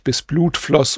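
ordinary delivery

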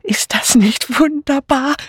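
high, slow, breathless thrill; excited gasping. A narrator's reading. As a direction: gasp it in high-pitched delight. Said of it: "keuchte eine weibliche Stimme". (begeistert gekeucht)

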